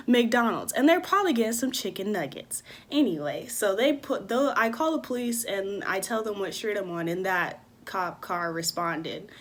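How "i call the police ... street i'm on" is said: ordinary delivery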